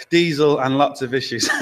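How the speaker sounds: ordinary delivery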